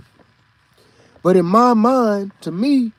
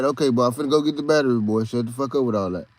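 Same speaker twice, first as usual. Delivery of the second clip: low, fast, quieter dad voice, lightly voiced; fed-up dad; 7 semitones lower, 4 dB quieter, faster than usual. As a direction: rattle it off low like an annoyed father. Dad snapping at crying son.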